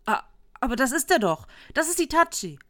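loud, high-pitched startled cry; stammered start into an excited shout; shocked recognition. A narrator's reading. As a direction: exclaim it as you suddenly recognise someone. Visitor recognises Itachi with alarm.

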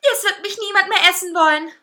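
wailing, high, loud outrage; screaming. A narrator's reading. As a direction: shriek in disgust and despair. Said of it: "schrie der Kuchen".

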